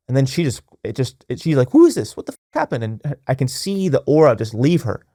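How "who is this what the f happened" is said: high-pitched mock bewilderment; shocked disbelief. Wife reacts to husband's sudden change, high and bewildered. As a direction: imitate a baffled wife, pitched way up.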